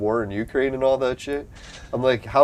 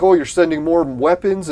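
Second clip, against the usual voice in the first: higher, louder mock enthusiasm; mock eager question; 6 semitones higher, 6 dB louder than usual. Mocking eager war backers, raised gushing voice. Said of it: "It's like".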